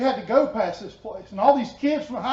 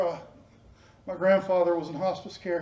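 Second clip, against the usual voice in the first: ordinary delivery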